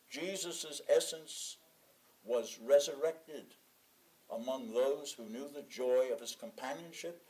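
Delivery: ordinary delivery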